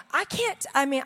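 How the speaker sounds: ordinary delivery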